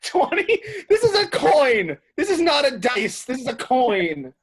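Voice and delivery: shouting in laughing disbelief; excited outrage. Real, not acted. Player outraged at coin.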